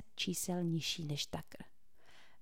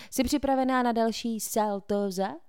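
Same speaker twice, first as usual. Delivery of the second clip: calling up; 4 semitones higher, 6 dB louder than usual. A narrator's reading. Cole asks from the stairs.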